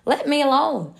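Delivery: high, loud acted protest; yelling out. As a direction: yell it out as the teacup. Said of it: "I yelled out".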